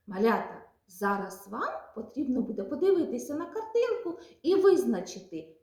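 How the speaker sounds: ordinary talk, nothing marked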